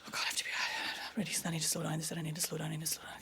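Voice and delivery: whispered, nervous self-talk; hushed panicky muttering to yourself; anxious whisper. Retold or imitated. Nervous speaker before a talk, panicky whisper. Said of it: "they go".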